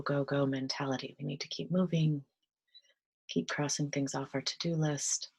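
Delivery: soft, calm meditation voice with whispered stretches; gentle guided meditation, drifting into whispers. Acted. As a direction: ease them into stillness, half-whispering.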